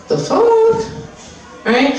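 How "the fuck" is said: squeaky, much higher dog voice, baffled; a puppy squeaking in disbelief; puppy's baffled squeak. Retold or imitated. Puppy baffled by the other dog's tricks, squeaky and incredulous. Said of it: "And she's like".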